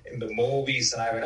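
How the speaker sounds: normal talking voice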